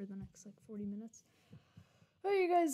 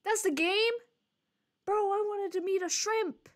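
much higher, louder disbelief; shocked that it's over already; incredulous outburst; 9 semitones higher, 6 dB louder than usual. Real, not acted. Streamer to chat as the game ends, loud disbelief.

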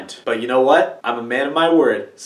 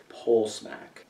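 much softer, low mumble; hushed; 4 semitones lower, 11 dB quieter, slower than usual. Shown as a demonstration. Trying out the suggestion to himself, softly. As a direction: let the words drop to near nothing. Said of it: "Is that like smacking a pole?".

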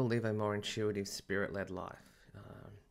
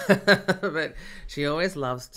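laughing; 7 semitones higher, 12 dB louder, much slower than usual. Real, not acted. Laughs about butting-in yaya.

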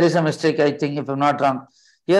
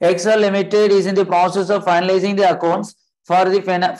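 ordinary talk

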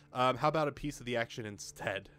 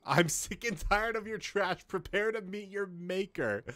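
hammed-up villain threat; 9 semitones higher, slower than usual. Reads hostile dialogue option in voice.

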